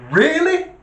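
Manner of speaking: high, loud disbelieving rise; you can't be serious; incredulous. Real, not acted. Storyteller reacting to the police dispatcher, incredulous high rise.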